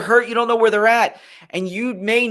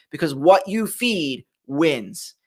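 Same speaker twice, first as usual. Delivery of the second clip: slow emphasis; 4 semitones lower, much slower than usual. Shown as a demonstration. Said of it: "hammering home the moral".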